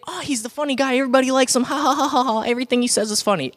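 high mock-gushing classmate; over-amused imitation with a fake laugh; gushing admirer. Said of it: "You would think".

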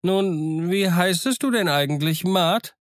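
high, eager voice of a lonely hermit; curious old castaway asking a name. Acted. Castaway asks Jim's name.

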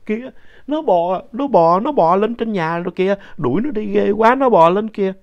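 put-on high, loud, far-off old woman's fearful cry; imitating grandma's terror; panicked grandma. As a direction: point and cry out in horror at the crawling thing. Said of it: "ngoại con lúc đó mới lấy cây gậy chỉ lên cao rồi nói".

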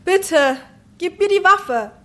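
sehr hohe, alarmierte Stimme; angstvolles Flehen. Acted. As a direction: flehe den Bewaffneten eindringlich an. Fleht Bewaffneten erneut an. (ängstlich flehend)

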